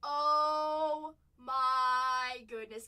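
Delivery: slow, drawn-out awed exclaim; stretched-out gasp. Real, not acted. Breaking a card box live for chat, drawn-out amazement. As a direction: let the words stretch in amazement.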